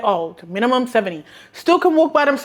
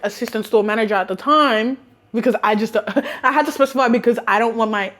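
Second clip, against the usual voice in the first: usual speaking voice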